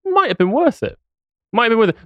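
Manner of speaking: high-pitched musing to himself; light, high mock reflection; weighing it up. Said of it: "I'll look around and go".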